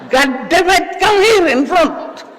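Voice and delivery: high, loud, slow shrill reprimand; shrill scolding professor. Retold or imitated. Professor calling a back-row talker to the front, shrill high scolding. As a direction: drag out the scolding in a high teacher's screech. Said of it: "boses, ano, siya, shrill".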